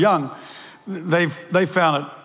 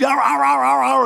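imitated dog barking; 5 semitones higher than usual. Pastor imitating the cattle dog barking. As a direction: bark like a cattle dog in the back of a ute.

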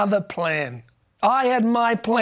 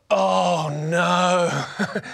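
mock dismay; 3 semitones lower than usual. Dismayed thought at being found out.

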